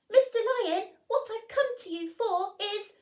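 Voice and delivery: high, loud squeaky mouse voice; a tiny mouse daring to wake a lion; timid little mouse. Acted. Mouse politely waking the sleeping lion, high squeaky.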